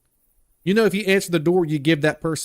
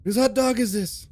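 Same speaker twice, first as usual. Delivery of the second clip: light Seagal voice, pitched higher; a hint of Seagal asking whose hot dog; lightly voiced tough-guy mumble; Steven Seagal impression; 7 semitones higher than usual. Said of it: "To do Steven Seagal".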